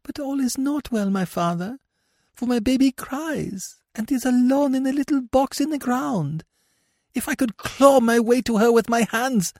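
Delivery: very high, pleading lament; anguished mother. Acted. Mother hears her buried baby crying, high and anguished. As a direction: plead in a high voice, rising to a loud outburst.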